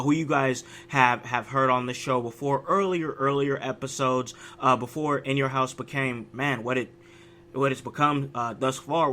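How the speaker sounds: ordinary talk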